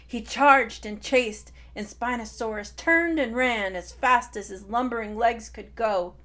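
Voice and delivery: usual speaking voice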